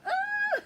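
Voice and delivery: very high, loud laughing shriek; squealed scream; mock scream. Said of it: "And she's like".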